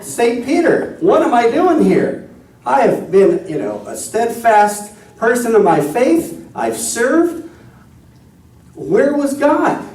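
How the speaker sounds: high, indignant complaint, slowing to the end; a wronged believer demanding answers, pitched high; indignant outburst. Retold or imitated. Man at the pearly gates complaining to Saint Peter, indignant and high. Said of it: "he's pissed".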